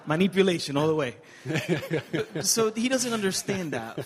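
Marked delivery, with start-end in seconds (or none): 1.4-2.4 laugh. Real, not acted. Laughing at his son's iPad ploy. 2.4-4.0 laughing. Real, not acted. Laughing at son's manipulation.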